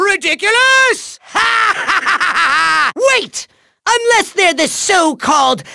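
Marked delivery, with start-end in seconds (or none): 1.3-2.9 scornful villain cackle; big mocking "ha ha ha"; evil laugh. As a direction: cackle in rapid, rolling bursts. Villain scoffs at the credits.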